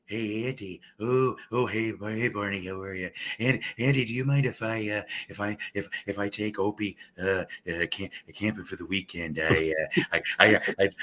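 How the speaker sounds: low, leering creep asking Andy a favor; hammed-up lecherous sidekick voice; lecherous Barney voice. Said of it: "I would go off into the mic".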